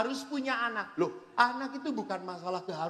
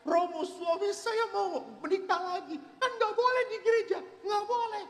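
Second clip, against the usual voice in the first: much higher complaining wife, half laughing; angry complaining wife; 12 semitones higher than usual. Retold or imitated. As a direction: whine to the priest as an upset wife. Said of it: "Istrinya marah datang pada saya". (istri yang marah mengadu, suara jauh lebih tinggi)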